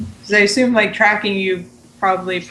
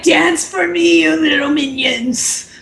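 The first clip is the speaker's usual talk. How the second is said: evil DM voice; 6 semitones higher than usual. Retold or imitated.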